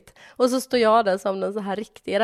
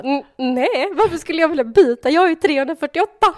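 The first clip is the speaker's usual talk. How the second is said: mock irritated man; 7 semitones higher, 6 dB louder than usual. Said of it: "Han bara".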